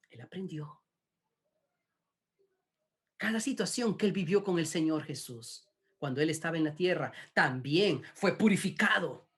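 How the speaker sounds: ordinary talk, nothing marked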